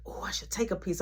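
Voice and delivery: unvoiced, hushed plotting; sneaky whisper. Friend plotting in mom's room, whispering. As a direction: whisper like you might get caught.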